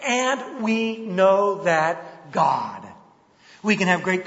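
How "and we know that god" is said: very slow, heavy quote; "And we know that God" drawn out, stress on "And"; emphatic reading. Shown as a demonstration. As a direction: read scripture with great weight. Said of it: "Paul emphatically states".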